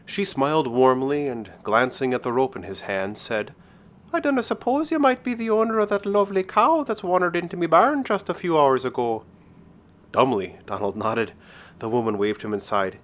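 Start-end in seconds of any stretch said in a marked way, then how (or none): 4.1-9.2 high-pitched woman's voice in an Irish lilt; lilting Irish countrywoman. Acted.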